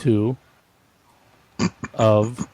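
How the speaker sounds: isolated words, long gaps between; halting sign-off. Real, not acted. Host finishing the show's title, oddly halting. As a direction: leave a big pause after each word. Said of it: "Why did you say it like that?".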